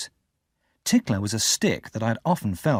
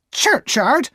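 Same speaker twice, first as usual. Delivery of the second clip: high, loud sharp echo of the word; sharp repeat; 13 semitones higher, 6 dB louder than usual. Acted. Sister sharply repeating Pip's answer, sharp. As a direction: snap the word back at him. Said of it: "repeated sharply".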